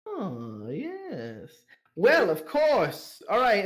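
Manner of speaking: drawn-out high "Well, of course"; pleased madam. Acted. Madam pleased by twenty silver stags, high delighted drawl. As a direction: sound suddenly delighted by the silver. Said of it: "So she goes".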